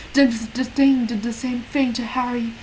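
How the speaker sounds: usual speaking voice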